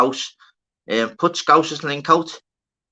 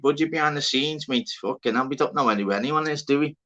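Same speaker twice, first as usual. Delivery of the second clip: ordinary talk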